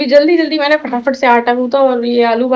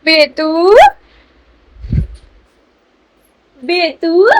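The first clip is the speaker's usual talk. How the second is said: coaxing call; 3 semitones higher, 3 dB louder, much slower than usual. Real, not acted. Calling to baby.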